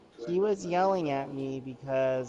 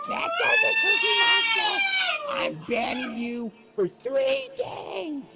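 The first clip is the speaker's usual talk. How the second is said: higher, louder mock yell of an angry gamer; imitated scream; 7 dB louder than usual. Friend banning him over the headset, imitated high, loud yell. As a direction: blow up at him like a bratty online friend. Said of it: "And he just screamed at me like that".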